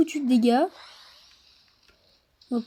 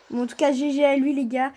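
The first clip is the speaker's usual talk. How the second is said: usual speaking voice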